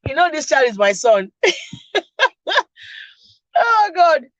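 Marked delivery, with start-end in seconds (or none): 1.4-4.3 real laughter, high and breaking; laughing to tears. Real, not acted. Host laughing at her son's typo, real crying laughter. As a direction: laugh helplessly through the words.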